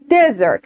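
Desert with stress on the first syllable, falling. Shown as a demonstration. Teacher models Desert for the class, stress first. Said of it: "روی قسمت اول باشه".

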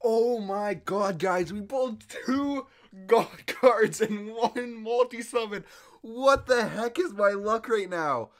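high, loud, slow amazed rambling; breathless excitement. Real, not acted. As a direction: still reeling from the lucky pull. Two god cards pulled.

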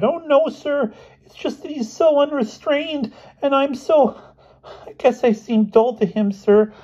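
very high, squeaky schoolboy; meek Elmer. Acted. Boy explaining his roommate to the headmaster, squeaky and meek.

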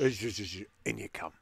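low, hushed bouncer ushering someone in; quiet, low shush and invitation. Acted. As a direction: usher them in softly and secretively. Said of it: "just go".

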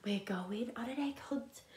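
soft secret whisper. Acted. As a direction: breathe it as a secret. Whispered refrain.

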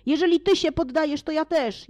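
high, eager call; eager shout. Acted. The companions shouting over each other, high and eager. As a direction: jump in after the others, eagerly. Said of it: "wołając jeden przez drugiego".

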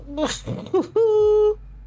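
high nervous burst of laughter. Real, not acted. Viewer laughs nervously at the creepy footage. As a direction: laugh off the fright.

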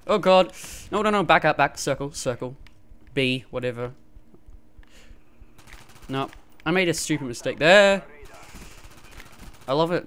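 usual speaking voice

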